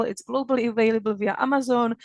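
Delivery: ordinary delivery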